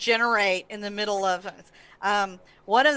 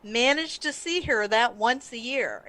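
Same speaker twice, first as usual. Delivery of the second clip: normal talking voice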